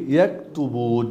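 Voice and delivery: ইয়াকতুবুন read slowly in Arabic; Arabic reading model. Shown as a demonstration. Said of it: "reads Arabic word as model".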